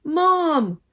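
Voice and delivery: high, loud yell for mom. Acted. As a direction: yell at the top of your lungs. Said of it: "yelled at the top of their lungs".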